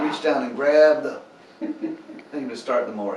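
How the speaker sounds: loud, worked-up grumbling retelling; begrudging. Retold or imitated. Tired golfer grumbling to the pastor, loud and begrudging. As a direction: vent a long tiring day with half-hearted complaint. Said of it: "he sounded a little begrudging".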